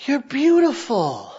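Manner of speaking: sleepy husband half-awake; groggy murmur. Retold or imitated. Husband waking from anesthesia, slow groggy murmur. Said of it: "he muttered".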